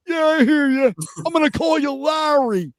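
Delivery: high cartoonish impression of a GM; mock Trent Baalke. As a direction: ham up a squeaky general manager. Baalke voice dialogue.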